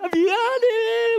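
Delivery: high, slow, wailing sorry; imitated crying apology. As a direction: blubber out an apology. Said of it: "저도 같이 우는".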